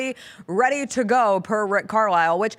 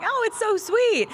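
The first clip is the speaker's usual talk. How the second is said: mock sweet gloating; 11 semitones higher, slower than usual. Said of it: "I'm like".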